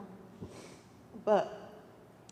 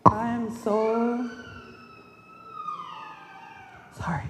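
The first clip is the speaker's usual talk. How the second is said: slow, tearful words forced out through tears; real grief catching in the throat; choked up crying. Real, not acted. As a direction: voice breaking as she apologizes. Granddaughter breaks down at funeral.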